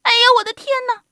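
high, loud, quick squeal of fright; old woman crying out. A narrator's reading. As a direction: shriek fast as a startled little old lady. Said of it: "瘦小的老妇人喊起来".

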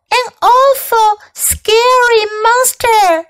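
put-on high-pitched frightened child voice; scared little girl. A narrator's reading. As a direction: tell your parents like a scared little girl. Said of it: "said Amanda".

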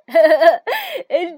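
loud breathless burst of laughter; loud gleeful laugh. A narrator's reading. Two friends in a snowball fight, loud gleeful laughing. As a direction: laugh out loud from pure fun. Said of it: "尽情地笑着、尖叫着".

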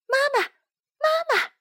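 very high tadpole call; high-pitched childlike cry for mother. A narrator's reading. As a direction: call after mum in a little voice. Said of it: "于是追上去喊".

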